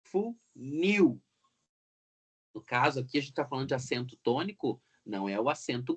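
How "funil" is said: Funil split up, NIL drawn out high; teacher's demo of Funil with a strong final syllable; stress on last syllable. Shown as a demonstration. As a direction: punch and stretch the NIL of Funil. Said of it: "O acento recai na última sílaba".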